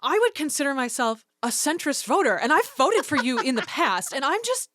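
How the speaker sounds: bright, loud, overly polite caller voice; sweet phone voice for a politician's office; customer service voice. Retold or imitated. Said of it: "I put on my customer service, uh, theater voice".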